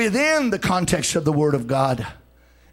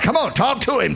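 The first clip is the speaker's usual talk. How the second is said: ordinary delivery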